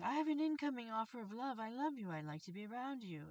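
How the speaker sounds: high, gushing mock love offer; imitating a suitor. Imagined admirer offering love, mock gushing. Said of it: "That's someone saying".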